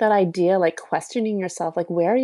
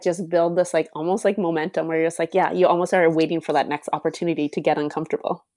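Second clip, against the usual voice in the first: normal talking voice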